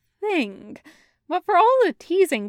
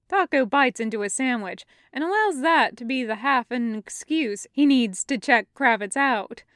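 ordinary delivery

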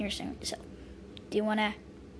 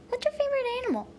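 put-on eager child; 13 semitones higher, much faster than usual. Child voiced asking mom.

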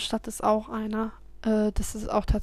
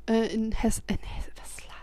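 whispered trailing off; 9 dB quieter than usual. Real, not acted. Losing track, muttering.

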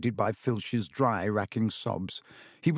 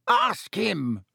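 shrieked; 8 semitones higher than usual. A narrator's reading. Caretaker accusing the boy, shrieking. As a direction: fling the accusation at him. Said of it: "shrieked Filch".

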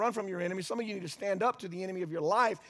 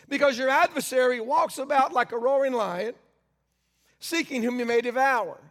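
scripture reading; 3 semitones higher, 5 dB louder than usual. Acted. Rereads scripture verse.

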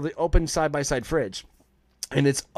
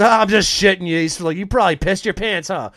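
louder, higher mock taunt of a prankster uncle; gleeful teasing; 5 semitones higher, 7 dB louder than usual. Uncle gloating after scaring the stoned nephew, loud teasing. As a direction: crow it like you just pulled the prank.

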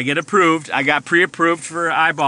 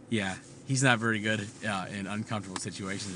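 hushed aside; 7 semitones lower, 11 dB quieter than usual. Retold or imitated. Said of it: "the nurse being like".

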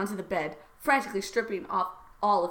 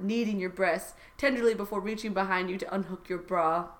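normal talking voice

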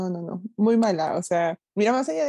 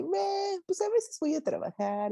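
lazy date; 9 semitones higher, slower than usual. Retold or imitated. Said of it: "Él era así como de que".

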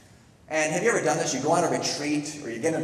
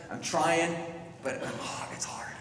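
weary; 3 semitones higher, 4 dB quieter than usual. Acted. Voicing struggling believer's weary prayer.

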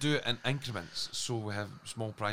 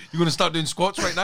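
loud taunting; 6 semitones higher, 6 dB louder than usual. Acted.

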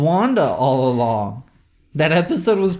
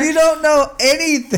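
teasing; 11 semitones higher, 4 dB louder than usual. Real, not acted. Teasing co-host, pitch jumps high.

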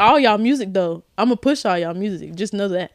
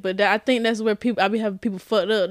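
normal talking voice

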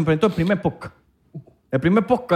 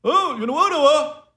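high, whiny mock of a friend; 10 semitones higher than usual. Teasing Santi for refusing to record, high whiny mock. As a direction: mimic him refusing, higher pitched. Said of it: "Santi, muévete".

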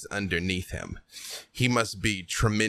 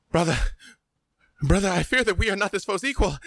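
much higher, fast, urgent plea; pleading brother Armand; 11 semitones higher, faster than usual. Acted. Armand begs his brother to flee, high and fast. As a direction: rush the words out, begging him to back down. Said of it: "he puts a hand on your arm and he says".

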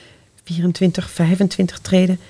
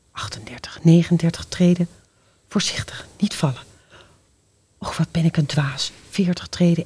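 whisper; 4 semitones lower, 6 dB quieter than usual. Acted. Creeping down stairs.

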